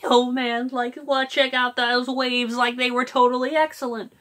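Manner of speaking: chill surfer voicing Shaggy; Shaggy as a mellow beach dude. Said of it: "kinda like that surfer dude".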